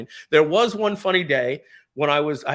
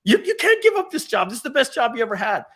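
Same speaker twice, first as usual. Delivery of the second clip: high, loud scolding wife voice; mad wife; 13 semitones higher, 5 dB louder than usual. Retold or imitated. Wife scolds him for quitting the job, high angry voice. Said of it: "she was mad at hell".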